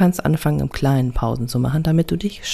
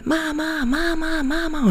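put-on high, whining child calling Mama over and over; 11 semitones higher, slower than usual. Child tugging mother's arm, whining high for Mama. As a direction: tug at her like a nagging little kid. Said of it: "das fünfhundertste Mal".

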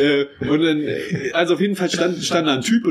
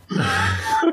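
high laugh. Real, not acted. Telling a friend the toilet story, bursting into a very high laugh.

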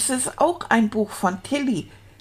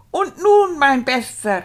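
higher, louder duke's exclamation; calling out; 5 semitones higher, 5 dB louder than usual. A narrator's reading. Duke greeting his returning knight, calling out. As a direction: call it out grandly as the duke. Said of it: "rief der Herzog".

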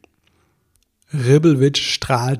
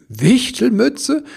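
high, loud, slow incredulous echo; drawn-out astonished query; amused disbelief; 11 semitones higher, 6 dB louder, much slower than usual. Acted. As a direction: repeat it as a rising question, close to laughing. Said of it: "fing bereits wieder zu lachen an".

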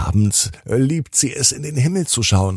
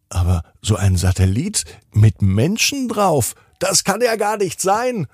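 Tina ruft; 10 semitones higher than usual. Acted. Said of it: "ruft Tina hoch".

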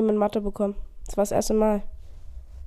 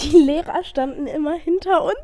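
high laughing through the words; 10 semitones higher than usual. Real, not acted. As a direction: crack up while saying it. Laughing at pun.

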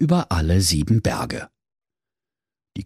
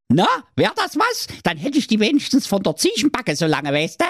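much higher, wheedling goat voice with a laugh; scheming mother goat; 13 semitones higher than usual. A narrator's reading. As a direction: coax the wolf into the plan, sly and chummy. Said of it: "Da sprach die Carmen Geiß".

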